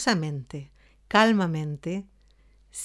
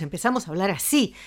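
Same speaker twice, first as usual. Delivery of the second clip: fast, hurried delivery; restless and rushed; faster than usual. Shown as a demonstration. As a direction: speed up like you are anxious. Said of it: "Si estamos inquietos". (habla inquieta y acelerada)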